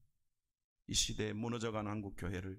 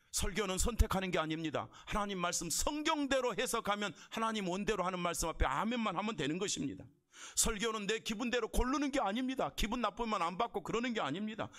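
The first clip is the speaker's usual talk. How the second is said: driving pulpit insistence, pitch mostly raised; emphatic preaching; 10 semitones higher than usual. Real, not acted. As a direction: lay down the rule to the congregation.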